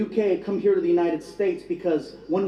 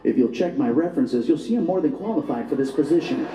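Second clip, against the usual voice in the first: lower, polished interview voice; good job voice; 6 semitones lower than usual. Acted. Applicant selling himself at an interview, good job voice. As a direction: clean it up for the interviewer. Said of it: "trying to get a good job voice".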